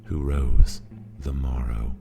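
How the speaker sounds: plain reading voice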